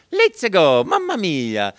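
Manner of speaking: high, loud, bouncy Italian accent; cheerful Italian plumber. Shown as a demonstration. Actor demos the chosen Italian plumber voice, high and bright. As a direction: pop it out like a happy Italian plumber. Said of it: "It was".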